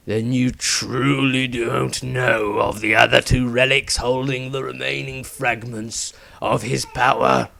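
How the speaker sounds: slow, menacing villain voice fading to a breathy end; slow dark sneer from a dying zealot; dying cultist. A narrator's reading. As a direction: gloat through the pain.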